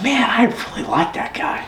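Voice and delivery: high, soft, fond inner voice; imitated inner voice. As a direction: play the smitten inner thought, up high and hushed. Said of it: "and you're just like".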